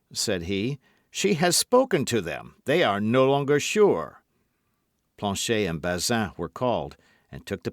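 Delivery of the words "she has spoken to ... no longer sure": louder, higher urgent warning; firm raised voice. A narrator's reading. Lord de Winter warns the others, loud and firm. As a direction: insist the lackeys can't be trusted.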